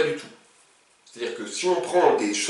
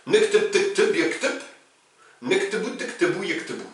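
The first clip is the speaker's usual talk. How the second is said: Moroccan conjugation with the vowels swallowed, nektep tektep; Moroccan Arabic; 3 semitones higher than usual. Shown as a demonstration. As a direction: recite "Naktub, taktub" the Moroccan way with reduced vowels. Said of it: "Là, j'ai parlé marocain".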